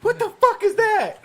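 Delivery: high, slow incredulous squeal; laughing in disbelief. Real, not acted. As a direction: crack up in shocked disbelief. Shocked laughing at negative IBU.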